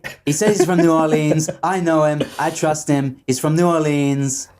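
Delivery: presidential parody as a contest host; Donald Trump impression. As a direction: hype the contest like the parodied politician. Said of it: "That's my Donald Trump impression".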